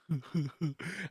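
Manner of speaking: soft acted chuckle; acted laughing. Fear dripping away at his voice, soft chuckling. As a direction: chuckle quietly, acting out the moment. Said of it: "I just start laughing".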